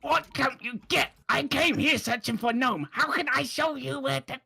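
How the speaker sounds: high-pitched, exasperated character voice; exasperated captive. Acted. Game master voices the exasperated captive.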